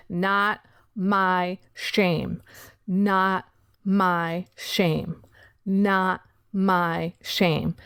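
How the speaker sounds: each syllable of "Not my shame" pressed slow and firm; pushing each syllable. Retold or imitated. To herself, pushing the shame away, slow firm syllables. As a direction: push "Not my shame" away, slowly, word by word. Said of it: "I said that like I was pushing it away".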